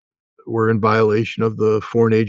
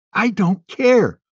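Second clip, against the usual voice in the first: defiant dismissal; 10 semitones higher, much slower than usual. Real, not acted.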